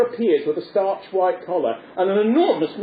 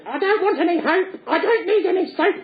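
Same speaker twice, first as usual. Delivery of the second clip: much higher puppet voice, giggling; Punch squawk; 12 semitones higher than usual. Retold or imitated. Punch mishearing the policeman, high giggly puppet squawk. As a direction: squeal it, playing dumb. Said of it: "And Punch says".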